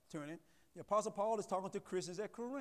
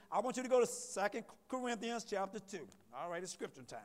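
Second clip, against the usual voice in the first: ordinary talk, nothing marked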